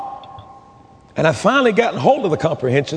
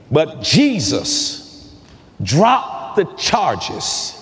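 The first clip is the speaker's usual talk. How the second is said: slow, weighty declaration with long pauses; preached testimony; much slower than usual. Real, not acted. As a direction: draw out each phrase as solemn testimony, rising on the pleading.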